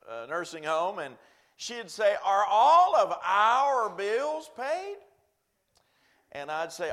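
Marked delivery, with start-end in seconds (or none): 2.2-5.0 put-on high, loud and slow old woman asking; slowly and carefully, like an anxious old lady. Old lady voiced asking about bills.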